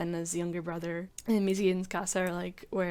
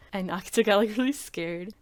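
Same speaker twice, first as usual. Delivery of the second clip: usual speaking voice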